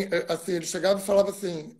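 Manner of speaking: ordinary talk, nothing marked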